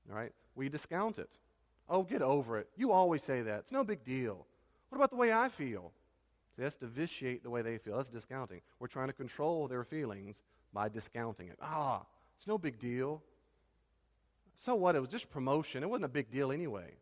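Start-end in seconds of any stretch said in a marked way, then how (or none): 1.9-5.9 loud, dismissive brush-off, rising at the end; dismissive scoff. Retold or imitated. Spouse brushing off a partner's hurt, loud and dismissive. Acted discounting quote. 11.6-17.0 dismissive shrug. Retold or imitated. Acted discounting quote.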